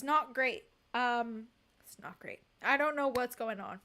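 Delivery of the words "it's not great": unvoiced whisper, lower; deadpan whispered repeat; whispered verdict. Real, not acted. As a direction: breathe it out as a whisper.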